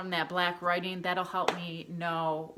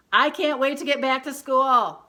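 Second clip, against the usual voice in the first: loud, high-pitched exclamation; excited; 7 semitones higher, 12 dB louder than usual. Shown as a demonstration. Teacher demonstrating an exclamation mark to pupils, excited. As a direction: burst out with eager excitement. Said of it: "'cause I'm excited".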